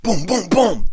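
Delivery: high-pitched spoken knocking noise; harder knocking. Acted. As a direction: pound out insistent booms. Knocks harder after complaint.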